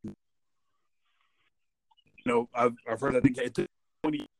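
fading phone line. Real, not acted. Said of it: "You're, you're fading in and out".

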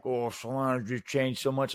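lightly voiced grumbling old man; a hint of a complaining elder. Mocking old men about a changed town, light old voice. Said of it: "that eighty-year-old guy that's like".

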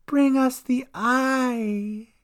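high, very slow creature command; Dolly. A narrator's reading. Dolly orders Cat and Gran, slow menace.

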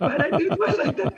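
high, loud laughter over the words; laughing hard at the memory; real laughter, fast. Real, not acted. Laughs about husband's jealousy.

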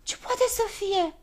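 high-pitched character voice; puzzled little fellow. A narrator's reading. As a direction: wonder aloud in a high voice.

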